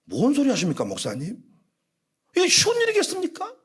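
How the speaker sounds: mild retort climbing to a high, quick protest; acted indignant protest. Elder answering the pastor's question on tithing, acted indignant protest. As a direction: play an elder objecting to the pastor.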